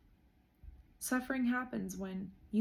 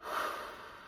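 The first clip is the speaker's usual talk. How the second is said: long breathy exhale; deep sigh; 6 dB quieter than usual. Real, not acted. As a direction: let the air out slowly. Breathing exercise.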